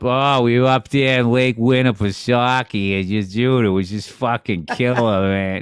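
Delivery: put-on a thick New Hampshire Boston accent. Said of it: "greatest fucking Boston accent".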